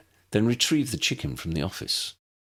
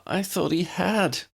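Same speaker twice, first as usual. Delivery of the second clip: moaned; 12 semitones higher than usual. A narrator's reading. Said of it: "Tally moaned".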